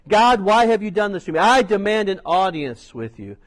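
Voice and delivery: loud, indignant demand; Job's demand. Acted. Job demanding an audience with God, loud.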